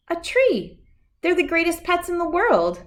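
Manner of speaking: put-on high, eager child insisting; little girl voice. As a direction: sell the tree as the best pet. Abigail insists on a tree.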